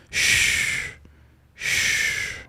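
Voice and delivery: breathy hush, no voice at all; whispered shh with a hand over his mouth; shushing whisper. Retold or imitated. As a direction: hush him like a secret. Masseuse hushing him, hand on mouth.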